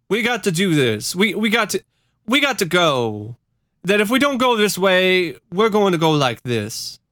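high, insistent urging; halting, urgent call to follow; Jim Jones. Acted. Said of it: "Jones came down off the podium".

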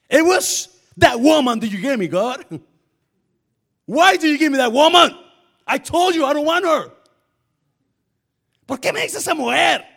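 loud complaining whine, blaming; Adam whining. Acted. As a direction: protest loudly like a sulking man. Adam blaming God, acted quote.

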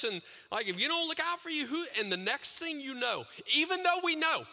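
high, loud sermon climb; impassioned preaching. Acted. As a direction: preach it with rising fire. Preaching builds to full force.

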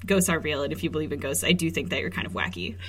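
normal talking voice

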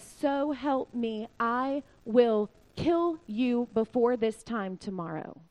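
slow, deliberate mock threat; menacing. Jezebel vowing to kill Elijah, slow menace. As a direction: space the words out as a retold vow of revenge.

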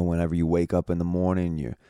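ordinary delivery

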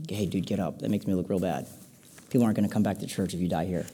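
low, hushed comic aside; soft, low-pitched pretend urging; mock private plea. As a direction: coax him quietly, as if nobody should hear. Paul privately coaxing the youth, comic.

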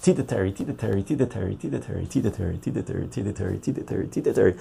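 fast repeated tongue twister; racing through the tongue twister on a dare; rapid tongue twister. Shown as a demonstration. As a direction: rattle it off ten times quick. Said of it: "say that ten times fast".

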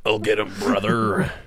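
low wrestler impression; Hulk Hogan. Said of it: "Hulk Hogan".

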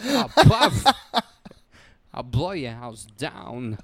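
slow, halting storyteller, lightly voiced; odd pauses like Walken reading; Walken three little pigs. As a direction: Walken doing the wolf, mildly hammed. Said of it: "he does the three little pigs".

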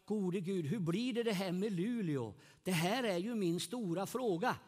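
normal talking voice

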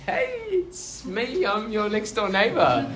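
nervous high-pitched hello at the door; a shy teen's squeaky, slowed greeting; awkward high greeting. Retold or imitated. Teen knocking on neighbour's door, nervous high voice.

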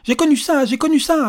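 plain reading voice

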